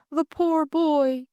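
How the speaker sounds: high, drawn-out cry of pity; distressed cry. A narrator's reading. Lady Coote seeing Jimmy lying wounded, crying out in pity. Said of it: "cried out".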